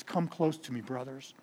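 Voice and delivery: soft, hushed and tender; tender invitation. Acted. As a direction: draw them in gently, like a brother. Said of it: "he spoke tenderly to them".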